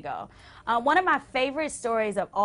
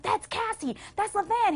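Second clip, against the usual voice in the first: excited; 12 semitones higher than usual. Retold or imitated. Excited whisper-shouting at a show.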